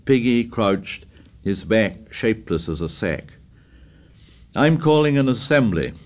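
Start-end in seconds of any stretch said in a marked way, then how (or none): 4.5-5.9 raised voice calling out. Acted. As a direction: call it out over the crowd. Ralph calls out to the tribe.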